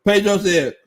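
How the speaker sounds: ordinary talk, nothing marked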